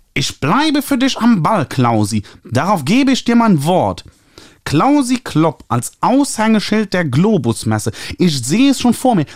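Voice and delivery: high, nasal, gushing publisher voice. A narrator's reading. Publisher Ernie calling the author, high nasal gushing. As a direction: trumpet it through your nose like an eager publisher. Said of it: "anstrengendes Näseln".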